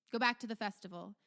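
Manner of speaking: quick, firm command; commanding Duke. Acted. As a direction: dismiss the crowd with authority. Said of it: "the Duke, uh, regards everyone".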